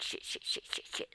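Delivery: urgent unvoiced whispered curses; frantic whisper. Hiding on the neighbor's porch, whispering in panic. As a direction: hiss the curses so nobody inside hears. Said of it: "I'm like".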